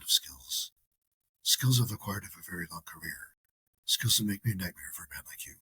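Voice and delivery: low, hushed, menacing phone threat; quiet gravelly kidnapper-call menace, low and soft; impersonating a movie hero threatening a kidnapper over the phone; Liam Neeson Taken impression. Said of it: "here's an impersonation for you".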